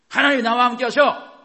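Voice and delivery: high, forceful faith declaration; shouted declaration. Shown as a demonstration. Preacher showing congregation how to speak out, shouted. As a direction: shout it out with full conviction. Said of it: "이렇게 말하시라고요".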